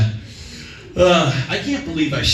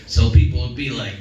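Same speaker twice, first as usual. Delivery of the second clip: normal talking voice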